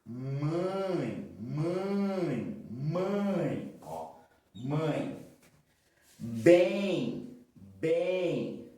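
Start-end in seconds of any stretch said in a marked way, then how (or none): 0.0-5.3 "Mãe" held through the nose on ãe; nasal diphthong shown. Shown as a demonstration. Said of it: "pelo nariz". ("Mãe" bem nasal, arrastando o ãe)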